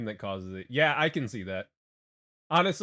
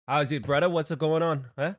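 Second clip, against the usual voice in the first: easygoing Hawaiian pidgin, a bit louder; Hawaiian pidgin accent; 5 dB louder than usual. Retold or imitated. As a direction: drop casually into a Hawaiian pidgin greeting. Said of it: "when I drop into the accent".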